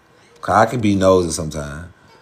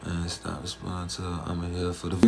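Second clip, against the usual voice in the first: much softer, lower aside; quiet aside; 3 semitones lower, 14 dB quieter than usual. Real, not acted. Streamer reading a viewer's warning about her, quiet aside. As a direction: say it low, half to yourself.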